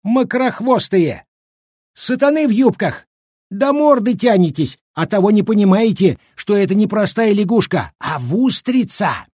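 put-on high-pitched, exasperated old man's cry; angry outburst. A narrator's reading. Old cook fed up with the women, shouting angrily. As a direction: cry out in high indignation, stretching the final word. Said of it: "разозлённый бабами щукарь не крикнул".

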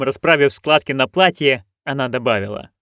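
ordinary delivery

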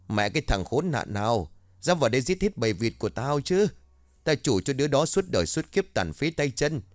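höher, zeternd fluchen; wütendes Schimpfen. A narrator's reading. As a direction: die Nachbarin flucht laut über den Täter. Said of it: "tiếng chửi bới".

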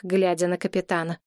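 ordinary delivery

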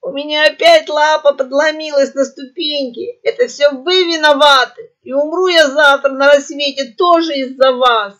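high, loud gurgling parrot howl; gurgling wail. A narrator's reading. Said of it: "взвыла булькающим голосом".

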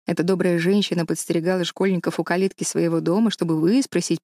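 usual speaking voice

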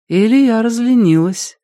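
high, light self-teasing; amused. A narrator's reading. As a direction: tease yourself with a smile. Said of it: "с улыбкой сказала себе Криденс".

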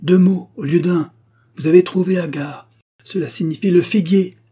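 high, loud excitement of discovery; exclaiming. A narrator's reading. Eustache telling Agar the riddle is solved, exclaiming. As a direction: announce the breakthrough eagerly. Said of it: "s'écria-t-il en se levant".

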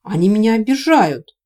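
loud, high, plaintive protest; wounded, rising whine of protest; embarrassed complaint. Acted. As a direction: complain to your uncle like a picked-on boy. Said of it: "покраснел Корвин".

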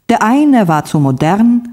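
ordinary delivery, nothing marked

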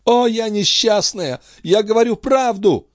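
very high, wretched outburst; anguished cry. A narrator's reading. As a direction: cry out in despair. Queen's anguished confession. (отчаянный высокий вопль)

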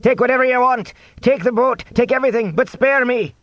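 high, frantic begging; terrified high-pitched pleading; crying out. A narrator's reading. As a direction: plead for your life in a very high voice. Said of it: "he cried".

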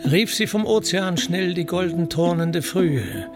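ordinary delivery, nothing marked

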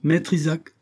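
high, firm address; commanding warning; stern call. A narrator's reading. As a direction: call him to order. Captain sternly warns the moneylender.

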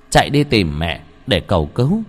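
ordinary delivery, nothing marked

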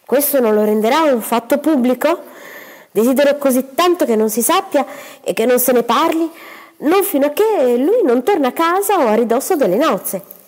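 plead, worried it gets out; young woman's voice. Acted. Cinzia pleads to keep secret. (giovane donna ansiosa, voce più acuta)

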